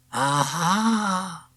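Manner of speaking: put-on a pleased old man's exclamation; old man's aha. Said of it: "he said".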